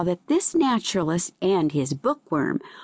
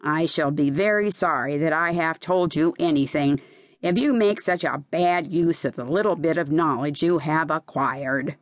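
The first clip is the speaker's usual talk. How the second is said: ordinary delivery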